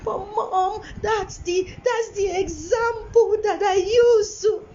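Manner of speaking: high, whiny tearful child impression; imitating a sobbing son pleading; crying child. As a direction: wail it like a child in tears. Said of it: "he was already crying".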